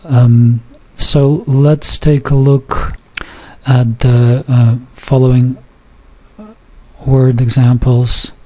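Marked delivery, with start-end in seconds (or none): none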